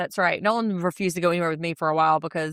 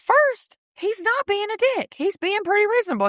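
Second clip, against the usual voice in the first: excited high-pitched telling; 11 semitones higher than usual. Real, not acted. Lively recap.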